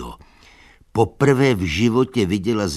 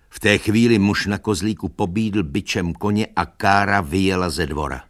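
plain reading voice

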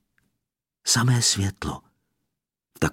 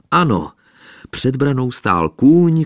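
usual speaking voice

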